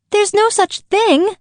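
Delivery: Alice angrily contradicting the Dormouse; angry outburst. Acted. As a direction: burst out in indignation. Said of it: "beginning very angrily".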